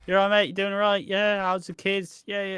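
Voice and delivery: high, chirpy lad's chatter; blokey mate. Retold or imitated. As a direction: chat like a matey lad down the pub. Imitating blokey greeting chat.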